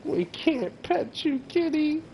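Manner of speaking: high, slow cooing voice for a kitty. Real, not acted. Talking to a game cat.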